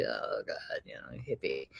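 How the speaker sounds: mock teenage grumble, barely voiced; grudging muttered reply. Daughter reluctantly agrees to join hippie mom, grudging mutter. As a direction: imitate a reluctant groan under the breath.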